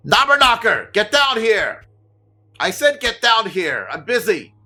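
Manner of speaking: high, loud bossy yell from another room; shouted from upstairs. Acted. Woman yelling down at the gnome. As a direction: bark at the servant from upstairs. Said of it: "and you hear".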